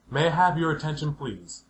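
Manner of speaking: ordinary talk, nothing marked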